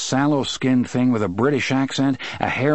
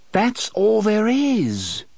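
sulky; 11 semitones higher, much slower than usual. A narrator's reading. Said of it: "looked more sulky than ever".

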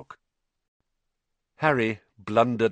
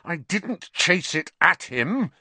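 slow, higher-pitched indignant denial; angry; 9 semitones higher, slower than usual. A narrator's reading. Harry denying Ernie's accusation, angry. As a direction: deny it hotly, word by word. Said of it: "his voice shaking with anger".

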